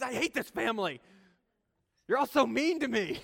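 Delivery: mock angry girl, high and loud; hammed-up tantrum of a preteen; sassy preteen outburst. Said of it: "But she'd say those things".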